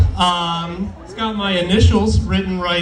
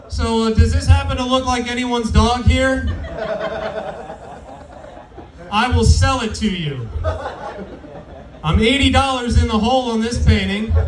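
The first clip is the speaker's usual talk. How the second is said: raised, excited bidding call; auctioneer; 5 semitones higher than usual. Pretend. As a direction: hype up the bids like an auctioneer. Comic auctions off his painting.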